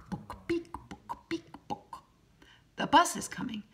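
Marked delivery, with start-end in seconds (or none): 0.1-2.0 high, soft clicking syllables; high heel clicks. A narrator's reading. Narrator imitating a lady's high heels clicking. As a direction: tap them out like heels on pavement. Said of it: "high heels go".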